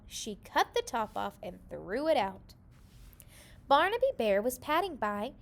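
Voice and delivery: usual speaking voice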